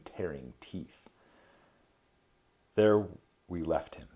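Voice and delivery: measured reading of an epic poem. A narrator's reading. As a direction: read the verse with gravity. Reads Dante translation aloud.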